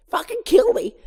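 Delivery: high, loud cry of despair; exasperated outburst. Acted. Vanessa snaps at Barry, a loud high exasperated cry. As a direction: blurt it out like she's had enough.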